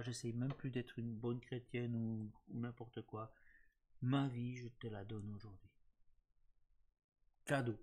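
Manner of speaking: quiet, earnest sermon voice; soft preaching. Acted. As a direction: gently urge her toward prayer. Encourages prayer, sermon-like.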